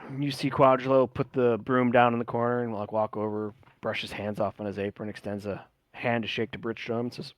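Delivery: ordinary talk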